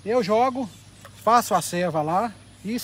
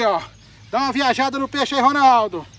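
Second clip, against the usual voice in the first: ordinary delivery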